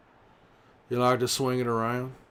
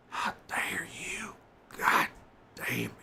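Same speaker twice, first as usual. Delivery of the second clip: indignant whisper; 8 semitones higher than usual. Acted. Whispering accusingly at a player who left.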